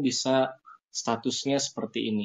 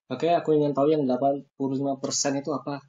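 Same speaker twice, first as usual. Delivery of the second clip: ordinary talk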